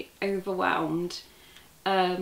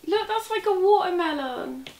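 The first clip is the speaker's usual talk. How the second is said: much higher, excited exclaim; delighted; 10 semitones higher than usual. Real, not acted. Vlogger exclaiming at a watermelon-coloured yarn. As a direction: point it out with delight.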